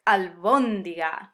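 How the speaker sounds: al-BÓN-di-ga, slow and punched on BÓN; stress on third-to-last syllable. Shown as a demonstration. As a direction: savor "Albóndiga", leaning hard on "bón". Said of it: "emphasis on the third to last syllable".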